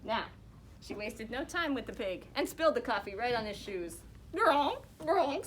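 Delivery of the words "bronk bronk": high, loud snorting "bronk"; pig squeal. Acted. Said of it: "squealed the pig".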